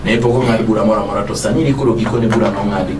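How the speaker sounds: ordinary delivery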